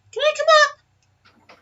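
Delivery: high, slow tiny creature voice; pixie. Acted. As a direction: ask like a little critter. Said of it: "and says".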